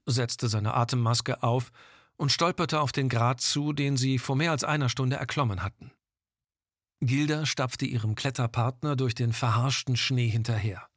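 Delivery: ordinary delivery, nothing marked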